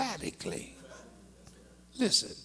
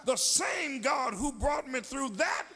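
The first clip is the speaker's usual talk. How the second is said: higher, climbing preacher's cry; preaching at full force; 6 semitones higher than usual. Acted. Preacher rousing the congregation, full-force preaching. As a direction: lift the church with it.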